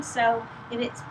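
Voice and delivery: ordinary delivery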